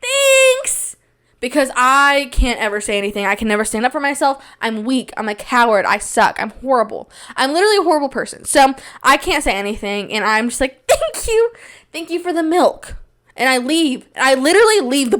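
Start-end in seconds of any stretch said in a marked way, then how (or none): 0.0-1.0 very high fake-polite squeak; forced gratitude. Retold fake gratitude for milk. 10.9-13.0 high, meek put-on thanks; forced politeness. Retold cowardly fake thanks.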